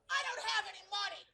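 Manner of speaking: high defensive yell. Acted. Comic to anybody who comes remotely close, yelling. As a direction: yell it out abruptly, pitched high. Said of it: "look up and yell".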